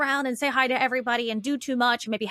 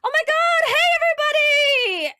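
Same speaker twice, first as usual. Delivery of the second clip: much higher, louder bubbly hello to the room; over-the-top greeting; 7 dB louder than usual. Retold or imitated. Entering a party, greeting everyone, gushing loud and high. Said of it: "I come into a room and I'm like".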